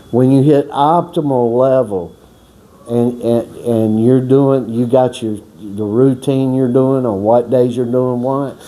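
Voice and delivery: ordinary talk, nothing marked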